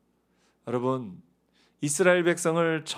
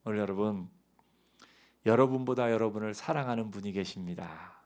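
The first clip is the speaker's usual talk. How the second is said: ordinary talk, nothing marked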